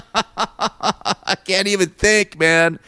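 slow words broken by laughter; laughing. Real, not acted. Homeowner recalling the flooded sink, laughing helplessly and slowly. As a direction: laugh through the words in disbelief. Laughing at his own misery.

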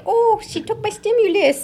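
high-pitched mock griping; mock complaint. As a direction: imitate an aggrieved client, pitched well up. Acted client griping about exes.

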